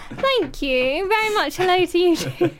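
giggling through the words; laughing. Real, not acted. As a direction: laugh while thanking him. Host giggling through greetings.

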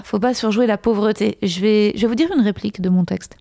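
usual speaking voice

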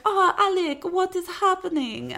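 high-pitched mock wife with a botched accent, slipping into Russian; botched Spanish accent. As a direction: imitate a Spanish wife, accidentally sounding Russian. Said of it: "sorry that was Russian".

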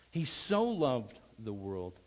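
slow delivery leaning on "so"; stress on so. Shown as a demonstration. Preacher returning to the verse, slow stress on "so". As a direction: lengthen "so", then ease off. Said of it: "emphasize so in your mind".

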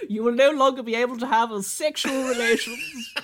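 a pompous official voice, pitched a little up; hammed-up solemn decree through laughter; mock formal pronouncement.

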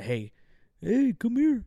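high, loud mock Maine accent on the call; Maine sergeant impression. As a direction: summon them in an imitated Maine accent. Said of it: "That's how he talks".

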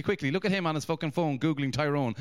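usual speaking voice

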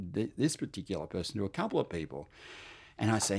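ordinary talk, nothing marked

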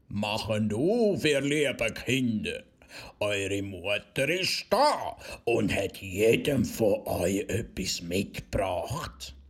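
slow, rough fake-mother voice at the door; rough wolf voice. Wolf pretending to be mother, slow rough voice. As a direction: lure them out, slow and rough. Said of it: "dass die rauche Stimme".

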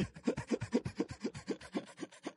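airy high laugh; breathless unvoiced laugh; God's laugh. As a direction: laugh as if you need to sit down. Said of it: "I need to take a seat".

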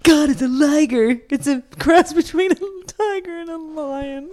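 high, exasperated nerd voice explaining the obvious; Napoleon Dynamite. Retold or imitated. Quoting a movie nerd on ligers, high fed-up whine. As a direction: sigh it out like a put-upon teen who has said it a hundred times. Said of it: "when I saw Napoleon Dynamite and he draws".

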